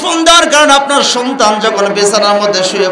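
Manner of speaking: high, loud emphatic preaching. Real, not acted. Preacher warning parents, loud and high. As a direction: drive the point home at full force. Preacher hammers home point.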